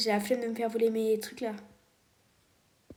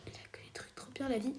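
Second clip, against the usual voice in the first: whisper; 13 dB quieter, faster than usual. Real, not acted. Whispers about rival's base.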